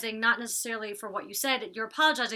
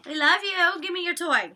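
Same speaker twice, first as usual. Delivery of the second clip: high dog talk; 8 semitones higher, 6 dB louder than usual. Real, not acted. Talking to her dog.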